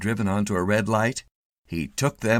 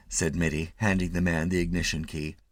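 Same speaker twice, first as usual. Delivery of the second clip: ordinary delivery, nothing marked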